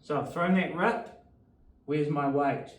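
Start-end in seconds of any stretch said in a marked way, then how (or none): none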